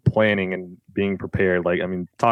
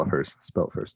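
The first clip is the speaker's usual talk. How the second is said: whisper; 6 semitones lower, 10 dB quieter than usual. Real, not acted. Cohost prompting the spelling joke.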